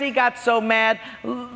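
normal talking voice

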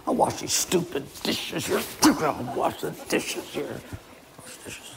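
hammed-up resentful muttering; grumbling. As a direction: grumble to yourself over the chore, fading away. Husband grumbling over the dishes.

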